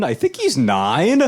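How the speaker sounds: a vague, slow dad unsure of his son's age; clueless dad. Retold or imitated. Dad answering the tutor about his son, slow and unsure. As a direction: shrug it off like a dad who never checked.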